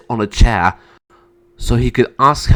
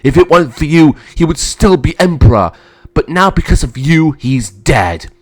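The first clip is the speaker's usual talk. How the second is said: raised, loud blame; angry accusation; 5 semitones higher, 6 dB louder than usual. Acted. Emperor blaming his brother for father's death, angry. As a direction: pile the blame on forcefully.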